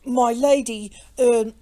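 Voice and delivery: loud, hesitant address with throat-clearing; falter, then clear the throat awkwardly; flustered stammer. A narrator's reading. Innkeeper clumsily bars the lady's way, flustered. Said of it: "came in feeble accents from Jellyband".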